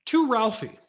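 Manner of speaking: high, loud gift-tag read; excited reading. Acted. Boy reading his gift tag, excited. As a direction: read the tag with a rush of hope.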